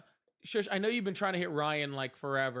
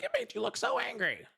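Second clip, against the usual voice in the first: high-pitched mock quote; put-on high voice for her words; imitating another woman. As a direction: retell her remark in a much higher voice. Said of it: "She's like".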